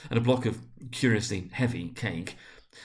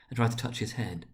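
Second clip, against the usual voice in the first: ordinary delivery, nothing marked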